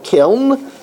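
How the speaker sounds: "kiln" with the N sounded after L; first pronunciation, with N. Shown as a demonstration. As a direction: close "kiln" on a clear nasal. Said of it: "That is the first pronunciation".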